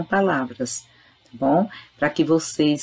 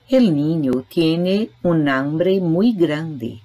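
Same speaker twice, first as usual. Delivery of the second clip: slow example reading; much slower than usual. Shown as a demonstration. Reads Spanish example sentence aloud.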